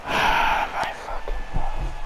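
voiceless, drawn-out sigh of despair; slow whispered curse on a long exhale; exasperated whisper. Real, not acted. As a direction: breathe the words out slowly, fed up. Exasperated after a bad play.

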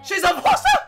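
real incredulous outcry; shouted disbelief. Real, not acted. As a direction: yell it as a stunned question. Disbelief at four star reveal.

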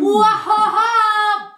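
loud high gleeful cackle; excited cackle. Real, not acted. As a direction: burst into a wild laugh. Excited burst after rooster crow.

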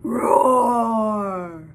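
low mock tiger roar, loud; hammed-up tiger roar for the baby. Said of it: "It says".

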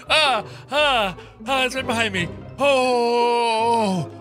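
high frightened cries; scared horror game streamer. Real, not acted. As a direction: panic as it closes in. Still chased.

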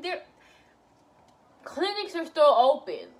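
real exasperated lecture, rising to a shout; heated rant. Real, not acted. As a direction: scold the viewers to go get tested. Ranting at viewers about STDs.

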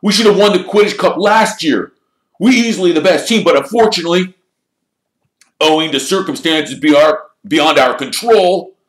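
loud, high-pitched rant to the team; aggrieved team-captain speech; displeased captain. A narrator's reading. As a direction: complain forcefully, voice pushed up high. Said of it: "Wood wasn't pleased".

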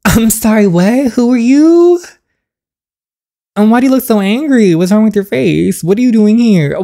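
high, loud startled demand. Acted. Son confronts father who turns up unannounced, startled. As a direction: confront the visitor loudly, pitch jumping up.

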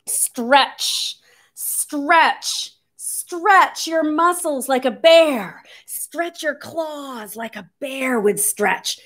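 slow pretend-stretch lead; drawn-out stretching. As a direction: stretch the words out with the body. Leads children stretching like bears.

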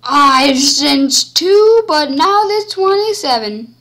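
loud, slow excited yell; excited shout. Real, not acted. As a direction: yell it in disbelief. Excited yelling in game.

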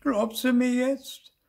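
very high, slow, loud question; piping drawn-out appeal; pleading little ghost. A narrator's reading. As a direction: press your uncle to believe you. Small ghost pleads for belief.

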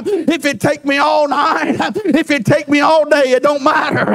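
ordinary talk

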